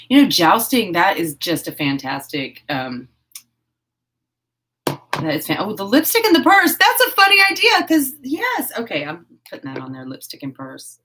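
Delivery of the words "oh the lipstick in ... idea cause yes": high, loud burst of delight; real gleeful excitement, much higher; excited. Real, not acted. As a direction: leap on a brilliant idea, pitch soaring. Seizes on a funny costume idea.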